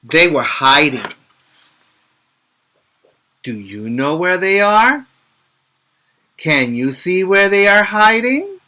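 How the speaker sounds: lively storybook read-aloud; picture book voice for young listeners; reading to children. Acted. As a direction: read it brightly to little kids.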